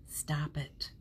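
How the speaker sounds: a quick whisper to herself; whispered self-scolding. Real, not acted. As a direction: hush herself, amused. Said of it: "I'm cracking myself up".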